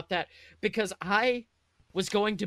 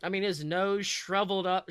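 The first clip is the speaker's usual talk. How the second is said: plain reading voice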